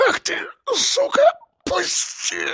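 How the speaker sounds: very high, quiet choking wheeze; strangled rasp. A narrator's reading. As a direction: rasp it out while being throttled. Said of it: "Хрипел он".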